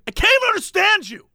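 high, fast outraged customer; hammed-up baffled shopper; mock indignant complaint. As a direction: protest that she makes no sense. Angry customer quoted.

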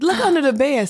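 insistent order. Retold or imitated. Insisting they check bed.

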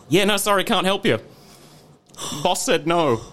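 high-pitched, bold retold refusal; newfound courage. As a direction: act out standing up to a customer. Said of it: "gripped with a sense of courage".

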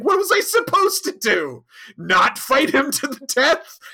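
high, loud, exasperated protest; indignant outburst. Acted. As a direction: throw up your hands, how could I not fight. Tom defending himself to Janeway.

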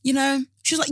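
ordinary delivery

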